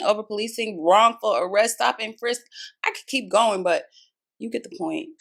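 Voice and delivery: ordinary talk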